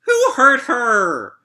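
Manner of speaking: high, slow, tearful wail; crying wail. Acted. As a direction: let the line break into a cry. Wailing the song line.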